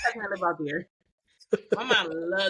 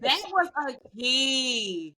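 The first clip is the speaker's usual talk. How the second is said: "That was a key" stretched slowly; drawn-out emphasis; slower than usual. Real, not acted. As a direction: draw out "That was a key", lingering on each word. Says it again, drawn out.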